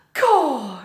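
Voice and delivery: high, loud exclamation; Mig exclaiming. Acted. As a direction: blurt it. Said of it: "she said".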